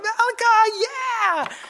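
very high celebratory whoop; acted cheering. Father celebrating his son's win, high excited cheer. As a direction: cheer with the name and a long "yeah".

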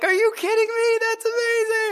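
very high-pitched thrilled exclaiming; acted amazed excitement. Father reacting to his son winning the game, retold elation.

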